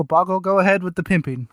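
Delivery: ordinary talk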